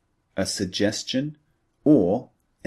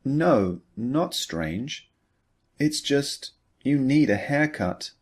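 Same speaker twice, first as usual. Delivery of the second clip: gentle reply; 6 semitones higher than usual. Acted. Mary's gentle reply in dialogue.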